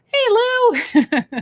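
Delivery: high, silly little potato voice; a potato toy calls to Lou in a high squeak; squeaky potato voice. Pretend. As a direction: squeak it out like a tiny talking potato.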